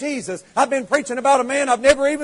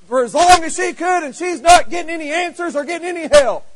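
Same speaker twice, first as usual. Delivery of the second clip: higher and louder, building to a shout; preaching; 6 semitones higher, 6 dB louder than usual. Real, not acted. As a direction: pile it on, rising each line. Preaching climbs to full force.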